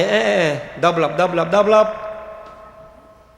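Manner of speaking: lightly mimicked grasping holy man; demanding Baba. Imitating a Baba demanding double from devotees, mildly mocking.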